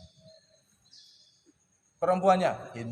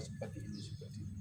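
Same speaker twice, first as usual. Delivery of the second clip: whispered echo; 5 semitones lower, 14 dB quieter, faster than usual. Real, not acted. Mengulang sambil berbisik.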